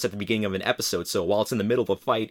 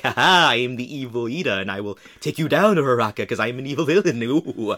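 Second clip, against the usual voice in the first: hammy stage villain; 4 semitones higher, 6 dB louder than usual. Retold or imitated. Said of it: "hamming it up, being an absolute villain".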